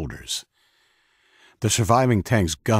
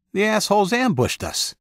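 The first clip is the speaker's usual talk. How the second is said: angry outburst; 13 semitones higher than usual. A narrator's reading. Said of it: "said the president".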